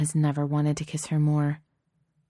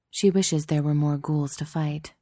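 usual speaking voice

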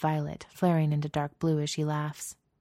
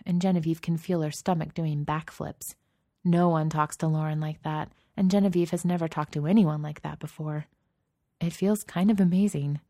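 plain reading voice

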